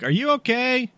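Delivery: calling down a hole. Acted. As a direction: shout it down the pit. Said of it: "I'm just gonna yell down really quick".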